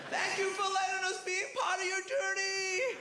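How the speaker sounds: high, slow, over-the-top American gush. Pretend. Comic to crowd, gushing as an American audience. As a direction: gush like an amazed American fan. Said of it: "If we were in America then".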